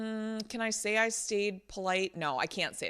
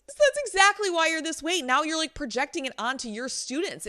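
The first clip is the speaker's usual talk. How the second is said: high-pitched, insistent retort; exasperated accusation; 9 semitones higher than usual. Retold or imitated. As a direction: re-enact driving the point home. Acts out her confrontation of coach.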